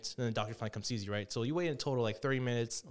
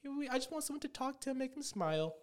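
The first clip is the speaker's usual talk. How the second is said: worried mother; 13 semitones higher, 5 dB quieter than usual. Retold or imitated. Retelling a cartoon, the mother calls in about her sad son, very high and soft. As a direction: plead softly like a fretful mom. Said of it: "his mom called it in".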